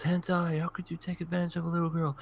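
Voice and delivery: high, quick mock scolding; imitated indignant girl. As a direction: mimic an anime girl accusing someone. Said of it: "she goes".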